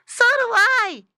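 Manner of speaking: very high, loud squeaky piglet; tiny piglet shout; little girl pig. Acted.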